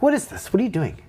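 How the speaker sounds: incredulous counselor. Retold or imitated. Counselor questions a student's sudden good grades, mock incredulous. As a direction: imitate a baffled counselor demanding answers.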